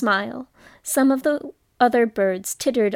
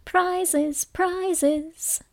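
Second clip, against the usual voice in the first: higher, jumbled excited cry; confused calling out; 6 semitones higher than usual. A narrator's reading. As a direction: call it out all at once. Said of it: "calling out in a confused way".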